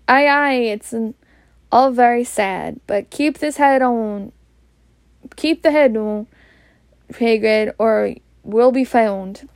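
usual speaking voice

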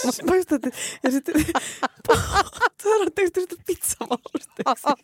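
high, helpless laughing question. Real, not acted. Friend amused by a nickname for talc, laughing hard. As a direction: ask it while cracking up. Cracking up at pizza spice nickname.

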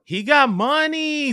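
high, slow mock line; imitating Quinta. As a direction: pitch it way up and drag it. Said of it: "she went from".

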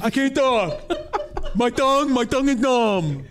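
thick numb-tongue mock voice; teasing, garbled-mouth impression; mocking imitation. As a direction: imitate a co-host tripping over a useless tongue. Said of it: "he's over here going".

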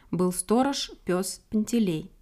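plain reading voice

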